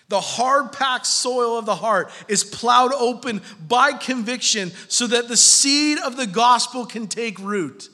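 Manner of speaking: loud, high, impassioned sermon; fervent preacher pleading with the crowd; full-force preaching. Acted. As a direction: pound the pulpit with urgency.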